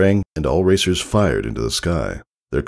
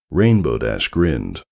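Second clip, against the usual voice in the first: usual speaking voice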